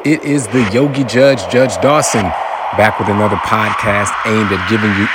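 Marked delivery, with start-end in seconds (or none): 0.1-2.2 town crier. Pretend. Podcast intro announcing the judge.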